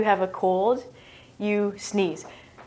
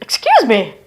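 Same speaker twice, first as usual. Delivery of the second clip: louder, startled mock apology; mock embarrassed; 8 dB louder than usual. Teacher pretends a burp slipped out, mock embarrassed. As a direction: play caught out after a burp.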